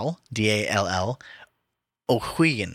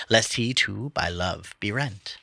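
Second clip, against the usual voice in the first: normal talking voice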